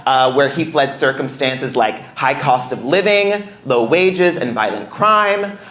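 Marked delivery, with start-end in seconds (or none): none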